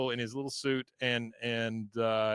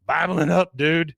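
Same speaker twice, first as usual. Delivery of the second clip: loud, higher mock teen bravado; hammed-up boisterous reply; brash shout; 5 semitones higher, 9 dB louder, slower than usual. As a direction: call it out like a cocky dude. Joseph's boast to Hank acted out.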